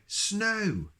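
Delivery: high thrilled cry of snow; excited cry. Acted. Mister Silly thrilled at the snow outside. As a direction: gasp it out like a delighted child. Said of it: "he was so excited".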